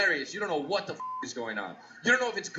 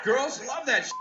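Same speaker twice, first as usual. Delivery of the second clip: ordinary delivery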